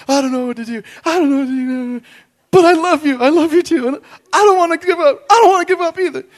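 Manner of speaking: high, loud, hammed-up tearful couple; couple's heartfelt plea. He and his future wife in the car, hammed-up emotional. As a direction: play both sides of the love scene, overdone. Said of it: "We were sitting in the car like".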